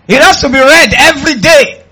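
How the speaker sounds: high, full-voiced preaching shout; loud exhortation. Real, not acted. Preacher urging the church to read daily, loud exhortation. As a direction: drive it home loudly.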